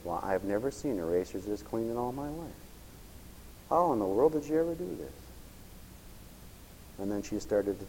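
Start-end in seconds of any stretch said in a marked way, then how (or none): 0.0-5.1 quiet, gentle praise; softly amazed teacher. Retold or imitated. Teacher praising the boy's clean erasers, softly amazed.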